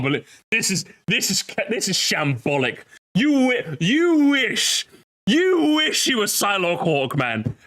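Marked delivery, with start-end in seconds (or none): none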